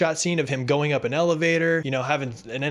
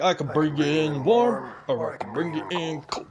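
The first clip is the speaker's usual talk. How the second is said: Mando impression. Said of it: "it's been like".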